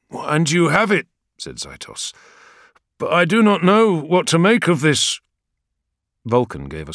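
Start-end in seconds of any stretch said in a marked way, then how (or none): 0.1-5.2 higher, louder uneasy warrior; troubled warrior. Acted. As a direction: admit doubt frankly. Zaitos's voice.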